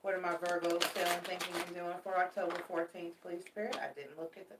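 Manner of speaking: ordinary talk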